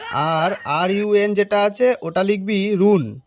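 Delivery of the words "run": "রুন" said as roon; wrong pronunciation. Acted. Bluffing teacher dictates "রুন" for run, mispronounced. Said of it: "উচ্চারণ ভুলভাল বলছে".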